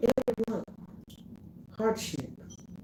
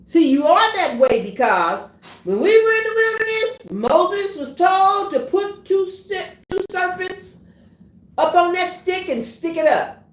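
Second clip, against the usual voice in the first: raised, high preaching voice; fiery preaching; 9 semitones higher, 5 dB louder than usual. Acted. Preacher lifting the story of Moses to the congregation, raised voice. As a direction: preach it out to the church.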